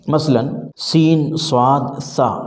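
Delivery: سین and صاد named slowly, one by one; letters spelled out slowly. Shown as a demonstration. Teacher slowly naming Urdu letters to students. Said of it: "ان تینوں کی ایک آواز ہے".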